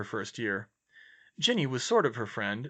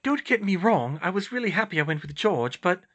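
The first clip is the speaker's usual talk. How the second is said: higher, louder character voice; flustered, pitched-up explaining; flustered heroine; 8 semitones higher, 5 dB louder than usual. Acted. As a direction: ramble on in embarrassment. Hermione rambles on, flustered.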